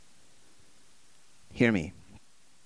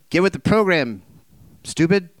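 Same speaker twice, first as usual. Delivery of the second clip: mock scolding; 4 semitones higher, 5 dB louder than usual. Mock God scolding Jonah.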